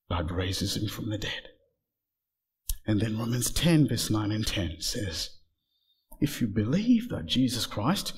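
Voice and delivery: low, soft, grave sermon voice; hushed solemn preaching. Real, not acted. Preacher tells of Jesus dying and being buried, hushed. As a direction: drop right down and leave long pauses between phrases.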